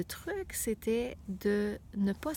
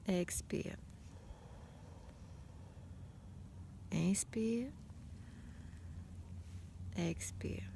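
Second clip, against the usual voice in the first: whispered breath cues; 9 dB quieter, slower than usual. Shown as a demonstration.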